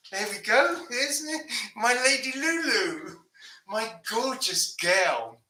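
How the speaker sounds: high, drawn-out cooing to a dog; doting pet talk. Real, not acted. Owner showing off a beloved dog, doting coo.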